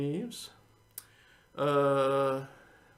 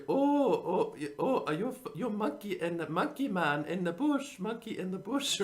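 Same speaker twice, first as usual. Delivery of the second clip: high, sing-song mock Chinese lady voice; a hammed-up Miss Swan; Miss Swan impression; 5 semitones higher than usual. To his audience, imitating the Mad TV character in a high sing-song voice. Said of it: "she was like a Chinese lady".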